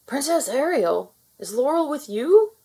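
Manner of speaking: loud, worried call from a frightened father; urgent and anxious, calling ahead; upset father. A narrator's reading. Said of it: "He sounded upset".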